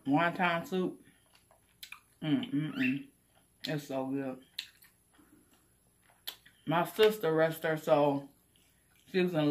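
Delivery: chewing while talking; savoring food between words; mouth full. Real, not acted. Seen 2: Fork in hand; chewing, closed-mouth movements through the labelled words.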